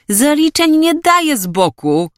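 high-pitched, amused explanation; tickled, pitched well up; still amused. Acted. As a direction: joke with your mate, voice up high. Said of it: "wyjaśnił koledze wciąż rozbawiony młodzieniec".

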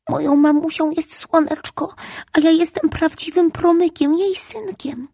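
put-on high little boy insisting hard; with emphasis. A narrator's reading. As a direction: press each word, he must be believed. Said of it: "powiedział to z naciskiem". (z naciskiem, wysokim głosem malca)

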